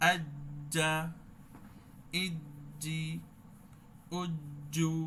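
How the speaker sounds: আজ্জা with a held stop on জ্জ; tongue middle to palate, stop; doubled jim drill. Shown as a demonstration. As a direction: Quranic jim practice. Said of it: "জিহ্বার মধ্যখান উপরের দিকে".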